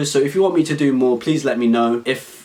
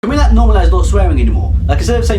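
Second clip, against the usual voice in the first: loud fast rant; 3 semitones higher, 8 dB louder, faster than usual. Acted.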